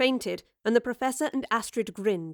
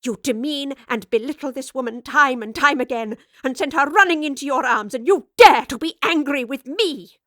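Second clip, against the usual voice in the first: raging; 4 semitones higher, 8 dB louder than usual. Acted. Continued raging at Raleigh.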